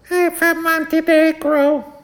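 put-on very high, slow kid voice; little boy. Little boy answering the cashier, squeaky drawn-out child voice. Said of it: "the little boy goes".

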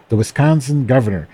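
usual speaking voice

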